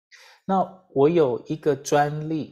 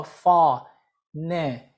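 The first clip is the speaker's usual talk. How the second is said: syllable by syllable; much slower than usual. Shown as a demonstration. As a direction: break it into far, ne, mic with pauses. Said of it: "这样叫做你会phonics".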